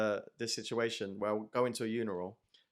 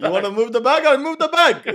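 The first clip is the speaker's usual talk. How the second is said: much higher, louder, laughing; mocking voice; 12 semitones higher, 13 dB louder than usual. Passenger answering the bag request, mocking and laughing. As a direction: play the passenger with a giggle. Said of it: "the guy goes".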